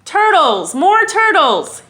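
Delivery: loud, high, drawn-out bellow. Acted. King turtle summoning the pond, high bellow. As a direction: bray it out across the pond. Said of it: "he bellowed and brayed".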